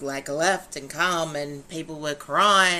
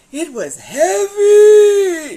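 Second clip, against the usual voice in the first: slow, loud, much higher wail; heavy cry; 13 semitones higher, 18 dB louder, much slower than usual. Real, not acted. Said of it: "Heavy for me".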